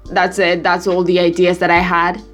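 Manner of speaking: ordinary delivery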